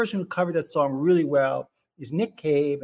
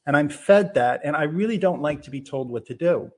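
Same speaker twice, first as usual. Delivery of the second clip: ordinary talk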